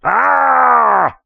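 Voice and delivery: high, loud fake scream of fright; hammed-up scream. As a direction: yelp like a cartoon coward. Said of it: "you say".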